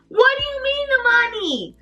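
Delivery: high incredulous echo of his words; outraged disbelief. Retold or imitated. Outraged at scammer's denial.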